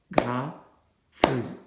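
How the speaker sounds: Agrafeuse drawn out slowly, each syllable apart: a-gra-feuse; clapped syllables. Shown as a demonstration. Parent helping a struggling child, slow syllable clapping. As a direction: clap along with each beat of Agrafeuse. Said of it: "en frappant les syllabes au moment où on les dit".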